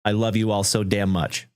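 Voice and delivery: ordinary delivery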